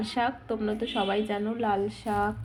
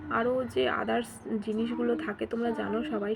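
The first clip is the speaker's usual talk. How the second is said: ordinary delivery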